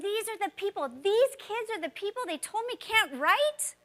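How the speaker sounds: high-pitched, emphatic indignation; real exasperation on the students' behalf; indignant. Real, not acted. Teacher defending students she was told can't write, indignant. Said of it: "It makes me mad today".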